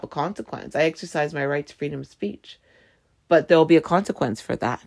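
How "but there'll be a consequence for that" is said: usual speaking voice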